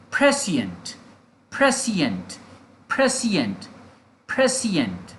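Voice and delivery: "prescient" as PRE-si-ent, each repeat falling; pronunciation drill. Shown as a demonstration. Teacher repeating for learners to copy, "prescient" as a falling model word. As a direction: drill "prescient" with pauses between, stress first. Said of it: "prescient is the pronunciation".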